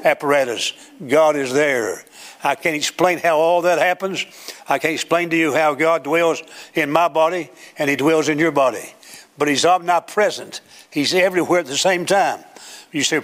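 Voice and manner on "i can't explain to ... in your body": ordinary delivery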